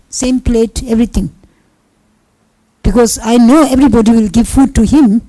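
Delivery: ordinary talk, nothing marked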